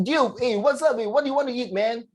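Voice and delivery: high, casual American dude voice. Retold or imitated. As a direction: play a chill American guy. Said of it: "like American".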